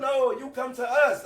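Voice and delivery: ordinary delivery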